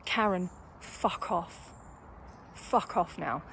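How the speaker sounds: low, slow, soft dismissal; restrained retold dismissal. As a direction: retell it quietly, dropping the voice well down. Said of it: "I finally had to say".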